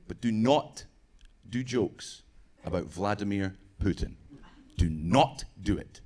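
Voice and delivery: low, halting, ominous imitation; mock grave warning. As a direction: warn him gravely, pausing between phrases. Russian friends' grave warning, retold.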